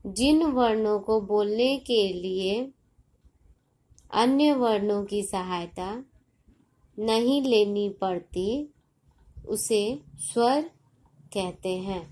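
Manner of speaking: slow, measured phrases with pauses between; slow dictation. Shown as a demonstration. Teacher repeating a definition to children, slow and deliberate.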